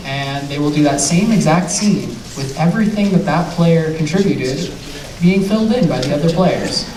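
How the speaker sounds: ordinary talk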